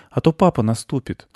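put-on high boy's question; high-pitched young boy asking gently; little boy. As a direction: ask in a high child voice. Boy talks to beetle.

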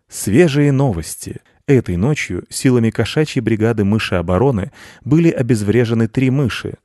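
cat playing a newsreader, formal and loud; pompous mock news broadcast; mock news read. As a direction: announce it like an evening bulletin. Cat reads news like announcer.

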